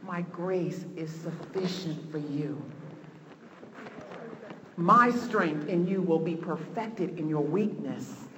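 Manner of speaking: soft, low and slow; hushed scripture. Retold or imitated. Preacher hearing God's words in the soul, hushed. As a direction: recite it low, letting each phrase sink in. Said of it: "I hear this scripture ringing in my soul".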